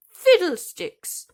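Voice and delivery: high, slow, loud squeak; squeaky dismissal. A narrator's reading. The field mouse dismisses Thumbelina's tears with a loud squeak. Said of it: "squeaked the field mouse".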